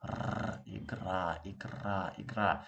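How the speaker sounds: igra repeated with a rolled r; igra, trilled r, falling each time; rolled r demo. Shown as a demonstration. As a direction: roll the r in igra slowly, three times. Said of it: "it should go like this".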